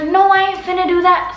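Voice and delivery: mock brother saying "ain't finna" in slang; slangy little brother. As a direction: toss off "finna" like the brother, high and loud. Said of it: "Sorry, I'm talking like he".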